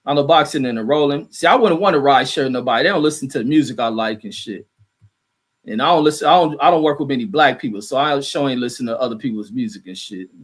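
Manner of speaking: usual speaking voice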